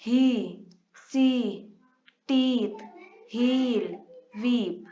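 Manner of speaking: "सी" held long and slow; slow ee demo. Shown as a demonstration. Teacher to students, drawing out the long ee sound. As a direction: stretch out "सी" so the long ee is heard. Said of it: "ई जी का sound भी produce करता है".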